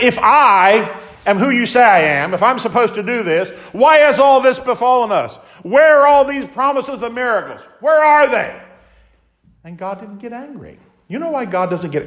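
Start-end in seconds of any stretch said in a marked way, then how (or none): 0.0-8.5 much higher, louder exasperated demand; pressing hard, fed up; annoyed Gideon. Acted. Gideon challenging the angel, exasperated and loud. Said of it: "Gideon was kind of annoyed".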